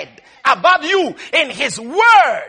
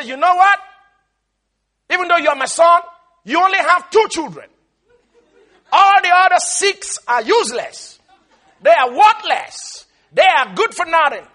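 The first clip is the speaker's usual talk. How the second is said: loud, raised-pitch mock tirade; acted scolding; 5 semitones higher, 5 dB louder than usual. Grandmother telling dad his children are useless, loud scolding. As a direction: denounce them emphatically, higher and louder. Grandmother berates her son, retold.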